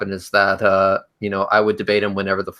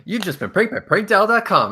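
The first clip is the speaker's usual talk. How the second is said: recorded announcer voice; 10 semitones higher than usual. Retold or imitated. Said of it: "It's like".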